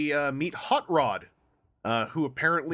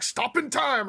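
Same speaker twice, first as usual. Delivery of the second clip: anime attack call; 9 semitones higher, slower than usual. Retold or imitated. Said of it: "He was always like".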